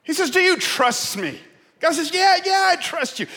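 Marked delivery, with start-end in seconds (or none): 0.3-1.4 loud, high question called down; voice from heaven. Acted. God tests the hanging man, calling down. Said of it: "a voice from heaven cried out". 2.1-3.2 very high, loud acted reply; frantic shouted agreement. Man on the branch assures God, yelling up.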